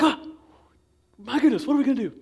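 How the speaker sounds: frightened men fussing, then near shrieking; panicked whisper-shout. Acted. David's men seeing Saul enter the cave, panicked high voices.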